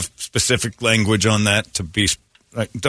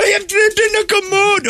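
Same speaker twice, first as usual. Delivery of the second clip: put-on much higher and louder Indian accent, shouting; mock Indian accent yelling; 4 dB louder than usual. Trapped man shouting at the crew, high mock Indian accent. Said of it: "in that voice".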